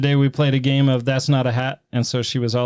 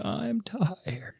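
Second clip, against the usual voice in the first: whispering; 8 semitones higher, 9 dB quieter than usual. Retold or imitated. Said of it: "if there's a game mentioned".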